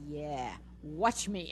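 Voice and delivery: low, slow dare; cheeky dog's taunt. Retold or imitated. Owner voicing her dog daring her, low slow taunt. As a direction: drawl it like a defiant pup. Voices the defiant dog's reply.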